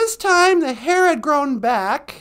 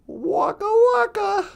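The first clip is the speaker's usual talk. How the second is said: Fozzie Bear; 6 semitones higher than usual. Acted. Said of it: "Fozzie Bear's voice".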